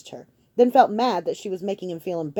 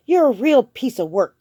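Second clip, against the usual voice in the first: bitter; 7 semitones higher, 9 dB louder than usual. Acted. Bitter accusation in argument.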